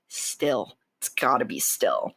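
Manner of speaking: low, soft, measured insistence; grave low-voiced pronouncement; firm decree. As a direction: drop low and quiet, laying down the rule. Quotes her own firm demand.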